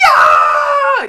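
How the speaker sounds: loud high shriek; yelling at the TV. Retold or imitated. Fan on the couch yelling at the TV, high shriek. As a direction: shriek at the screen. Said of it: "on my couch yelling at my T V".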